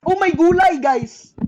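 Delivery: ordinary talk, nothing marked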